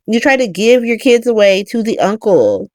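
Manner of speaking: usual speaking voice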